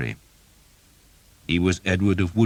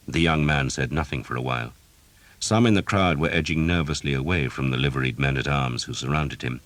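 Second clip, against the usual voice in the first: ordinary delivery, nothing marked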